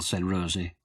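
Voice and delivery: plain reading voice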